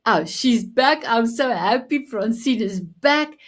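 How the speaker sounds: ordinary talk, nothing marked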